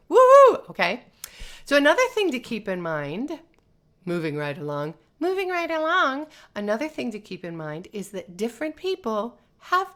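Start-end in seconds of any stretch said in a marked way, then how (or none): 0.1-0.7 a very high, loud cheering woohoo. Shown as a demonstration. Teacher showing how screaming teens can cheer safely, whooping. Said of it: "Teach them to say". 5.2-6.3 much higher, lilting moving right along; sing-song. Shown as a demonstration. Teacher moving to the next point in a sing-song. Repeated phrase, sung higher.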